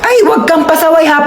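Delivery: normal talking voice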